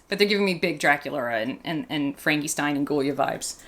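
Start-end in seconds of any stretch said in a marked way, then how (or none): none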